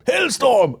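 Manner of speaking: high, loud attack cry; fighting-game move call. Acted. Comic as weatherman in fighting game, high mock battle cry. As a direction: shout it like a special move. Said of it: "he's just like".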